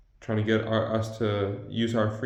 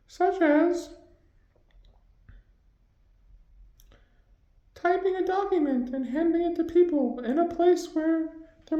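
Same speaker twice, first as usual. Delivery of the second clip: much higher, pitched-up voice. Acted. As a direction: talk in a squeaky high voice. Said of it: "Your voice can be very high".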